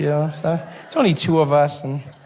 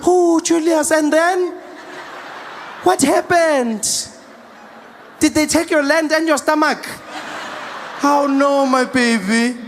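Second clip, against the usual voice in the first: fussing mom; 8 semitones higher than usual. Acted. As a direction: fret like a mom over a thin child. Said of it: "I felt like a mom".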